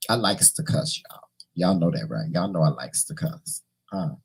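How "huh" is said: ordinary delivery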